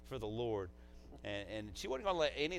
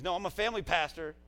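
raised, louder mock insistence; retold stubborn comeback, pitched higher; imitated firm correction; 8 semitones higher, 9 dB louder than usual. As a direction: contradict him as she would, adamantly. Imitates her correcting him firmly.

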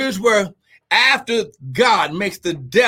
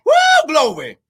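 high, loud joyful cry of praise; ecstatic woo; 7 semitones higher, 5 dB louder than usual. Real, not acted. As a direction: shout for joy in the Spirit. Preacher's ecstatic exclamation.

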